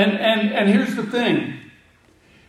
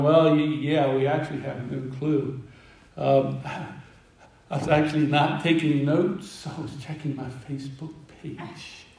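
low, soft, hesitant mock confession; acting out embarrassed students caught out; sheepish admission; 5 semitones lower, 6 dB quieter than usual. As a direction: own up reluctantly, sinking to a murmur by the end. Disciples sheepishly own up to Jesus.

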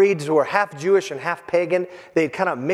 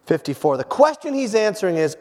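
normal talking voice

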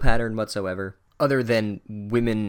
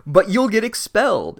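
mock whine; 9 semitones higher, 5 dB louder than usual. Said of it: "he's like".

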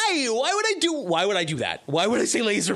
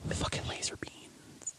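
whisper; 14 dB quieter than usual. Acted.

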